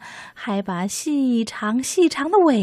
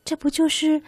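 little mouse voice; 10 semitones higher than usual. Acted. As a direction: muse happily in a tiny voice. Mouse thinks proudly.

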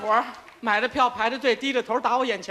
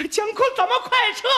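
wife voice; 9 semitones higher than usual. Acted. Wife urging retreat.

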